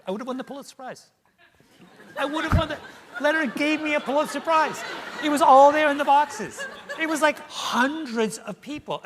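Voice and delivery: high, laughing exasperation at himself; giddy self-mocking laughter; rueful laughing. Real, not acted. Laughing at his own missed Pulitzer.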